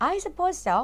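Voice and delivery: stiff, clipped answer; stiff reply. Acted. Mrs Dursley answering her husband stiffly. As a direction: answer stiffly, giving nothing away. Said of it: "said Missus Dudley stiffly".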